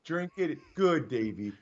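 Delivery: put-on soft, hushed, like giving medicine to a child; coaxing nurse. Wife feeding husband a Bloody Mary, soft and soothing. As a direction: talk him down gently as he drinks. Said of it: "be like".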